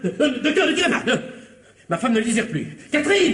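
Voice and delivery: frantic husband begging the doctor not to shoot; panicked pleading. Acted. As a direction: plead for your life, flustered.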